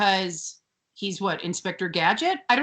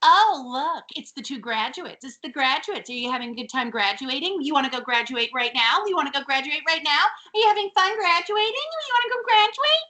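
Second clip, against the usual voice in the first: sing-out teasing, climbing higher and higher; mock-eager needling that builds to a squeal; taunting twins; 7 semitones higher than usual. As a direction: pester them with rising, excited mock questions. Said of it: "standing there like the fucking twins".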